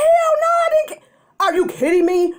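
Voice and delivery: high, loud, crying outburst; crying yell. Retold or imitated. Sister-in-law yelling at Erica in tears, loud and crying. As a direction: wail it out, half sobbing.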